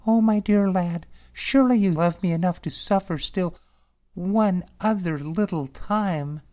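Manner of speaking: high, pleading, tearful princess; weeping princess. Acted. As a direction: beg him, a hint of tears. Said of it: "wept the princess".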